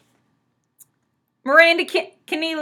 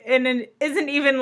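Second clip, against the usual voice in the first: ordinary delivery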